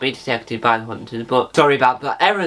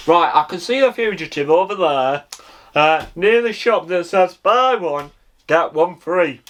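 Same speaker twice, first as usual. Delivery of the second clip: ground hunter; 4 semitones higher than usual. Retold or imitated. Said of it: "And he goes".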